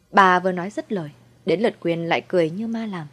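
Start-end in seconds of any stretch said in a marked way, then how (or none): none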